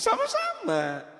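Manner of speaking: very high, drawn-out acknowledgement; playful reply. Acted. Teacher answers the student's thanks, playful high lilt.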